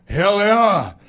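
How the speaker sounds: put-on loud Austrian-accented kid calls; drawn-out, thick-accented schoolkid shout; little Schwarzenegger pupils. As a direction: call out like a mini Arnold. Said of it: "the kids are like".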